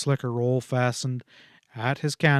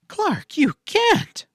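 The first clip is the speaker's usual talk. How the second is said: sharp outburst; 6 dB louder, much slower than usual. A narrator's reading. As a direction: cry out, much higher and louder, slowly drawn. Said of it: "she burst out sharply".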